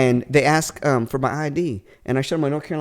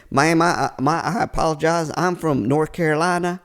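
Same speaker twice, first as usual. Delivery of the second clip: put-on Southern accent. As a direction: do a rough Texan-Carolinian accent. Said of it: "probably more Texas".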